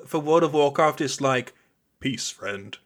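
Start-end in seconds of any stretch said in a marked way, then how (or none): 2.0-2.8 game NPC voice. Retold or imitated. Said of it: "the way that the humans speak in those games".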